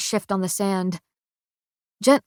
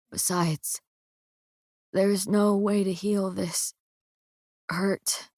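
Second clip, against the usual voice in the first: faint dying voice; 6 dB quieter, slower than usual. A narrator's reading. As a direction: let the life drain out. Dying Kai fading.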